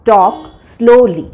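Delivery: "Talk slowly" drawn out very slowly; slow teaching repeat. Shown as a demonstration. Teacher repeating "Talk slowly" very slowly for students. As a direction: stretch every syllable of "Talk slowly" for learners.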